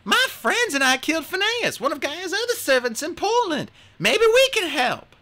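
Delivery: put-on urgent young heroine offering help; young Hazel. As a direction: Hazel asking the queen to let her help. Hazel pleading with the queen.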